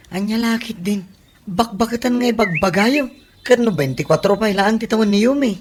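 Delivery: high-pitched, mildly exasperated protest. Acted. Acted protest to father.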